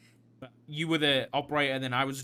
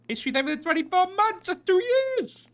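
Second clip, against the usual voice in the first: high, loud goofy proclamation; silly shout; 10 dB louder than usual. Acted. Blurting a nonsense announcement to the room, loud and high. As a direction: belt it out like a mock announcement.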